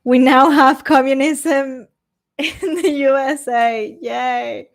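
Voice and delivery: slow mock cheer; sarcastically enthusiastic, dragging it out; sarcastic. Real, not acted. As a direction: fake celebration with a drawn-out delivery.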